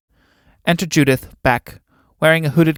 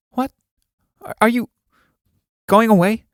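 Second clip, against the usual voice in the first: higher, halting startled question; startled disbelief; 8 semitones higher than usual. Acted. Judith learning Alan is leaving, startled and halting. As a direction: stammer it out, pitched up in surprise.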